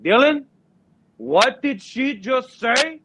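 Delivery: high, slow mock outrage; a dad losing it at his son; furious dad. Dad yelling at son before teacher, mock rage. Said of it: "he's like".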